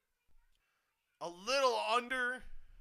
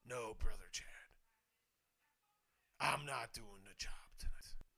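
unvoiced, hushed mock wrestler line; soft whispered impersonation; whispered quote; 12 semitones lower, 14 dB quieter than usual. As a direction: whisper it like a secret boast.

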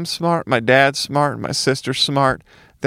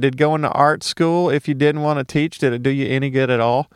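normal talking voice

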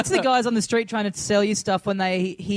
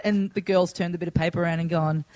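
ordinary talk, nothing marked

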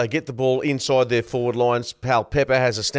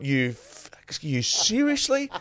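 swearing held back, then high, drawn-out "seriously"; retold exasperated outburst, voice leaping up; incredulous, bitten-off near-swear; filthy disbelief; 5 semitones higher, 4 dB quieter, much slower than usual. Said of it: "And I was filthy".

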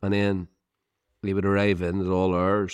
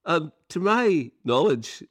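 normal talking voice

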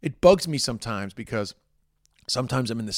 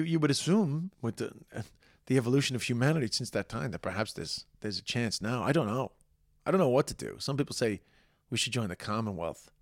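ordinary talk, nothing marked

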